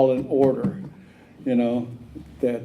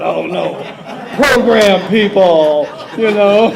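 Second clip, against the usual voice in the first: loud, laughing mock dismay, slowed down; laughing fake alarm at old friends; mock horror; 8 semitones higher, 12 dB louder, slower than usual. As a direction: cry out in joking dismay. Mock horror at meeting program people.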